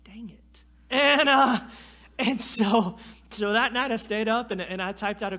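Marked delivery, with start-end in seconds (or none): 0.1-0.6 muttered. Retold or imitated. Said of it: "And I'm like".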